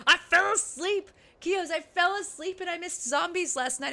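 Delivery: high-pitched exasperated complaint; mad at self. Real, not acted. Telling Kios about missing zombies, exasperated at self. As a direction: wail it high, annoyed with yourself. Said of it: "I'm so mad at myself".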